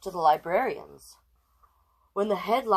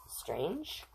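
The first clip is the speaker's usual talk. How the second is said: ordinary delivery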